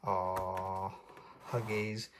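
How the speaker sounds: soft, low cooing over a hug; gentle aww huggies; tender aw. Real, not acted. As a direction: coo at something sweet. Reader coos at hug picture.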